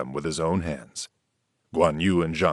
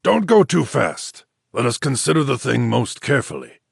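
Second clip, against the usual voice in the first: high and louder, then slowing down; urging caution; 6 semitones higher, 4 dB louder than usual. A narrator's reading. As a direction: hold them back with care. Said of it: "Wu Shi urged caution".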